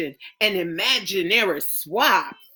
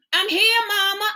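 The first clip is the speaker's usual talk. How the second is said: put-on lightly raised girlish pitch; a young girl calling back to her mother; hint of a little girl's voice; child answering; 11 semitones higher than usual.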